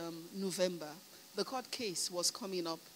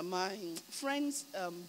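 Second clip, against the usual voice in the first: normal talking voice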